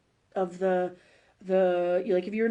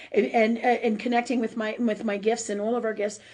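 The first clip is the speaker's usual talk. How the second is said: normal talking voice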